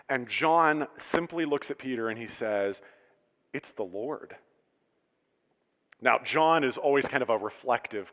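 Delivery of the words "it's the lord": hushed. Acted. John quietly recognizes Jesus.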